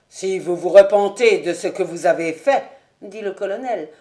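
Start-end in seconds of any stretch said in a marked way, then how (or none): 0.1-2.6 louder, hard challenge; stern anger. Acted. Colonel confronting Ravenswood, stern and loud. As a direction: throw his repentance back at him.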